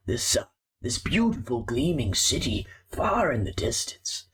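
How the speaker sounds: a lightly voiced old rat recounting the shipwreck, grave; old rat storyteller. GM voicing the old rat telling of the sea monster, grave.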